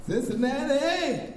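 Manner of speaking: high, drawn-out shout to the crowd; calling out cities. Real, not acted. Comic calling out hometowns, loud hype. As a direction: hype up each city.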